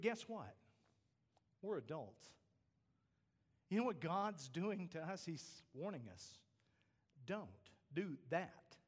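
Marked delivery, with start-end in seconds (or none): none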